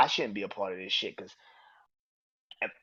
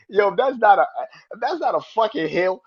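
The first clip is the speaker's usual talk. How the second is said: excited reaction; 9 semitones higher, 8 dB louder than usual. Retold or imitated. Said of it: "I was like".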